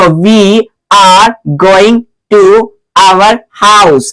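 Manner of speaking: word by word, with small gaps; slow example reading. Shown as a demonstration. Teacher showing students an example sentence, slow and separated. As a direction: spell the sentence out slowly, one word at a time.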